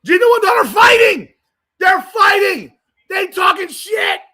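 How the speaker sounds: yelling high and loud in disbelief; excited shout. Real, not acted. Streamer yelling to viewers as the coaches fight, excited shout.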